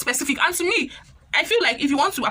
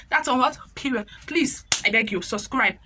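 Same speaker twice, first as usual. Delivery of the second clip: ordinary talk, nothing marked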